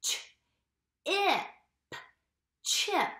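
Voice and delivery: Ch, ip, chip split then joined; segmenting chip for kids; tapping out sounds. Shown as a demonstration. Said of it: "Let's tap out chip".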